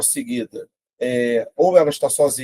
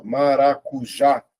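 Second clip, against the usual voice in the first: MARACUJÁ slowly, in measured syllables ma-ra-cu-já; much slower than usual. Shown as a demonstration. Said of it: "vou separar".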